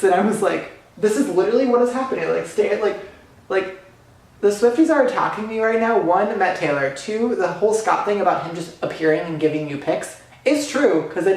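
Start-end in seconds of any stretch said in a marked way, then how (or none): 1.0-6.0 frantic disbelief. Retold or imitated. Overwhelmed, retelling her own panic.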